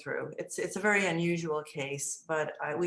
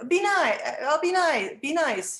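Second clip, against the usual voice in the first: high, loud imitated child promise; pleading child; 9 semitones higher, 7 dB louder than usual. Boy promises teacher he will behave, imitated loud pleading. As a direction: insist like a small boy bargaining.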